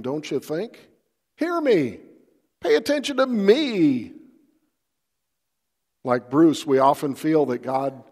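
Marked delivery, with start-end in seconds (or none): 1.4-4.1 higher, insistent mock demand. Pastor mimicking the psalmist's demand to God, insistent. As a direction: play the psalmist bossing God around. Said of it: "that's pretty darn demanding".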